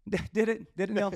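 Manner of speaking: mock innocent question, high and loud; sarcastic high. [name] mocks the golf coach with sarcastic innocence.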